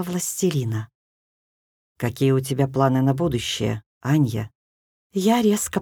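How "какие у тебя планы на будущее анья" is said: lower, calm male voice; низкий мужской голос. A narrator's reading. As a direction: speak low as the dark lord. Тёмный властелин говорит. (тёмный властелин, низким голосом)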